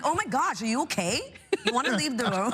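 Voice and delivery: high, fed-up mock concern; exasperated. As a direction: sigh it out in exasperation. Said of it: "I'm like".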